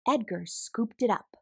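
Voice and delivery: plain reading voice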